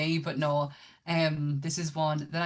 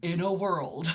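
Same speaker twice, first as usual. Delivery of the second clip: trailer voice; slower than usual. Retold or imitated. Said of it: "He has never said".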